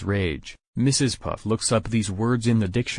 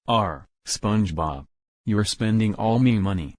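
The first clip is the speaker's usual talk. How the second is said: ordinary delivery, nothing marked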